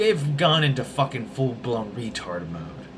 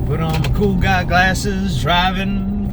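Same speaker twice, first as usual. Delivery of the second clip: strut it like a cool guy; 5 semitones higher, 7 dB louder than usual. Pretend. Vlogger putting on sunglasses in car, loud cool-guy swagger.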